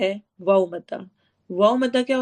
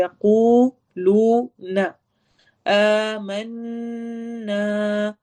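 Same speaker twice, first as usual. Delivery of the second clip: slow chanted recitation, madd stretched; Quran recitation; much slower than usual. Shown as a demonstration. Teacher reciting the verse for the class. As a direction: recite slowly, drawing out the long vowels. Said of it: "الف مدہ ہو رہی ہے".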